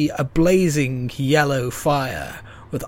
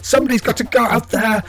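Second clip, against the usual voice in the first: frustrated outburst; 8 semitones higher, 4 dB louder than usual. Acted. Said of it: "and he goes".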